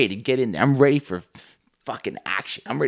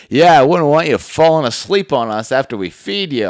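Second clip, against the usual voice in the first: imitating the homeowner; 3 semitones higher, 7 dB louder than usual. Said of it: "She goes".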